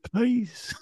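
high-pitched imitated begging; pleading. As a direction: stretch out a small, high plea. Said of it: "starts begging us".

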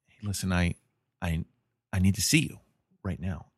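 low, hushed, hesitant phone voice; subdued retold plea. Young lady phoning the man she's seeing, low and hesitant.

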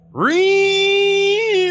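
high loud whining chainsaw imitation; shrill buzzing saw sound; chainsaw noise. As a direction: mimic a revving chainsaw. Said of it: "you start going".

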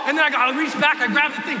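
usual speaking voice

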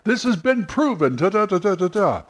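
snake oil salesman. Retold or imitated. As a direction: hype it like a carnival barker. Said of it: "a snake oil salesman".

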